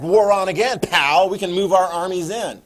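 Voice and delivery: loud, punchy call to arms; loud acted declaration of war; mock war cry. Voicing Allies resuming the war.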